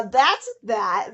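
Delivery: ordinary talk, nothing marked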